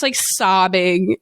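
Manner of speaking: usual speaking voice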